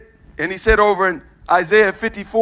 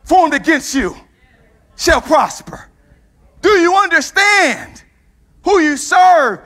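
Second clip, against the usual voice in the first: higher, louder climax, slow and shouted; preacher roaring the promise at the church; full-force preaching; 8 semitones higher, 7 dB louder, slower than usual. Acted. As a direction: build to a ringing shout on no weapon.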